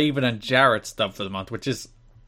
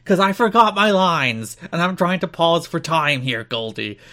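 hammed-up slow, high wrestler voice; theatrical tortured promo, easing down at the end; Raven; 7 semitones higher, 4 dB louder, slower than usual. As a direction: drag out the mock anguish, starting loud. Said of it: "Please do it in the voice".

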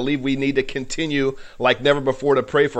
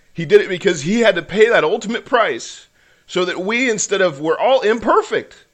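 rising, higher preaching build; 5 semitones higher than usual. Real, not acted. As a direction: preach it, climbing in pitch.

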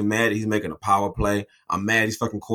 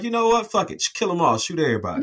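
high, decisive order to kill; 8 semitones higher than usual. Retold or imitated. She orders everyone killed.

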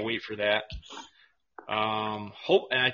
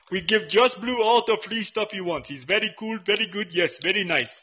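higher, louder broken-English vendor; Habib and Hakeem voice; 10 semitones higher, 6 dB louder than usual. Acted. Vendor gushing about Josh Blue in a higher, louder voice. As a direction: play the vendor for laughs. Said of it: "doing Habib and Hakeem".

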